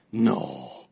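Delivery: mock deep friend saying No again; imitating Henry. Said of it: "that's him saying that".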